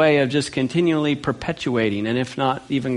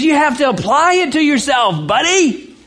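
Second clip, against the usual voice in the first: high, louder scolding friend; buddy yelling at a careless friend, raised high; exasperated buddy; 11 semitones higher, 3 dB louder than usual. Retold or imitated. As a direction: scold him like a frustrated buddy, much higher. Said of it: "And he says".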